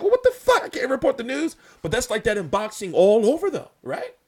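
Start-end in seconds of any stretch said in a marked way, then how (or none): 0.0-1.5 high, loud, incredulous outburst; a sudden shocked yelp at the critics; mock outrage. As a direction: throw it out, exasperated and disbelieving. Retelling his reaction to angry fans.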